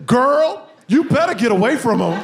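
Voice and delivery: mock sassy warning from the operator; operator's warning. Operator on the phone warning the wife, mock sassy. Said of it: "Then she says".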